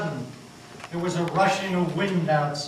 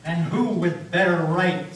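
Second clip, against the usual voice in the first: menacing smile; 3 semitones higher, 6 dB louder than usual. Acted. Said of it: "with one of his terrible smiles".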